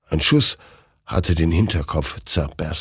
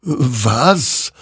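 higher, startled question; astonished; 6 semitones higher, 4 dB louder than usual. A narrator's reading. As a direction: ask it in sudden wonder. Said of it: "wunderte sich dieser".

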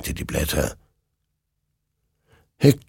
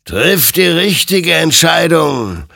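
higher, louder, slow stern warning; admonishing; 10 semitones higher, 7 dB louder, slower than usual. Acted. Spirit warns soldier Hector before he shoots: admonishing. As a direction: press him to choose right, slowly. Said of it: "ermahnte ihn sein Gegenüber".

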